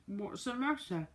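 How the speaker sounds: talking through cake; muffled with a mouthful of cake; mouth full. A narrator's reading. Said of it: "with her mouth full".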